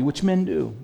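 normal talking voice

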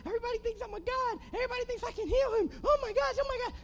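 high-pitched hammed-up yell; mock yelling. Preacher acts out a king tearing his clothes, high mock yelling. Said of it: "started yelling".

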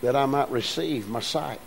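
quiet, understated ask; softly restating the blind man's request; soft, low simple request. Shown as a demonstration. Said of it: "he just simply asked".

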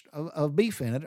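ordinary talk, nothing marked

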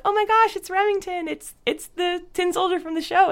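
high, breathless fan excitement; excited fans. Retold or imitated. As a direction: gush like a thrilled fan spotting him. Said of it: "people were like".

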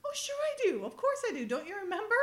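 high-pitched, eager protest in a retold quote; mock insistence. Woman insisting to her partner that she has ID, high mock insistence.

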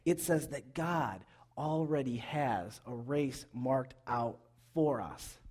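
ordinary talk, nothing marked

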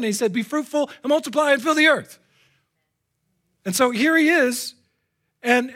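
high, impassioned pulpit delivery; ringing, fervent proclamation; full-force preaching. Real, not acted. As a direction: preach it with rising urgency.

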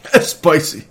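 "That's spicy" said through a laugh. Real, not acted. Repeats it laughing, far voice.

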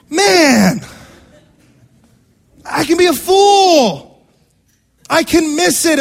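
loud, high, drawn-out outburst; exasperated shout. Acted. Preacher owning up to yelling at games, shouting in self-reproach. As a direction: cry out in exasperation at yourself.

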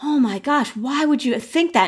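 high-pitched retold disbelief; acted dismay. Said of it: "like that stomach drop again".

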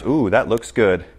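low, slow, soft longing; quiet tempted longing; tempted ooh. Acted. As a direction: savour the bait. Lured by the bait.

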